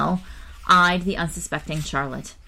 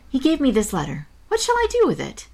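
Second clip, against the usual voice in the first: Charlotte voiced; 9 semitones higher, 3 dB louder than usual. Charlotte asks about the letter.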